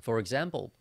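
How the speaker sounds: ordinary delivery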